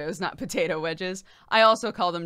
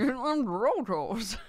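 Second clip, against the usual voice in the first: higher, garbled Southern drawl; thick Southern accent; 4 semitones higher, slower than usual. Acted. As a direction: mimic the bayou cook's thick accent. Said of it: "a real thick accent or something".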